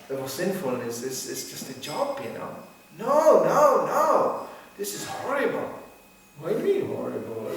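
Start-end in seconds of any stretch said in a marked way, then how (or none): 3.0-5.7 high, loud horrified no no no; mock horror at sin; shocked prude. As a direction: play the scandalised listener.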